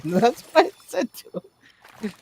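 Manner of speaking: high-pitched, breaking into laughter; laughing while talking. Real, not acted. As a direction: squeeze the words out through real laughter. Cracking up at own story.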